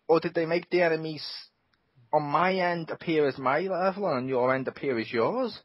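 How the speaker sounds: usual speaking voice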